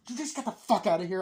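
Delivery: hammed-up irritated shout; mock angry snap. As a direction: snap at him to get out, quickly. Girl scolding the prankster.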